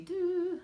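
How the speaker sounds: high sung "doo" filler; light, high singsong note; idle sing-song doo. Real, not acted. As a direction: hum a high little doo while searching. Filling time while scanning chat.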